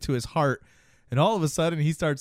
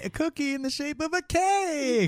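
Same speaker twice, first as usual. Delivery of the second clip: insistent explaining; 13 semitones higher than usual. Retold or imitated. Monster defends the cake to Maury, insistent explaining. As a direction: hold it up high, explaining the cake. Acted pleading about the cake.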